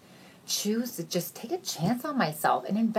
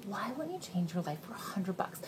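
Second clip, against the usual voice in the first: whispered plea; soft whisper; 11 dB quieter than usual. Shown as a demonstration. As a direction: breathe the question softly. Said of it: "repeat, softer whisper".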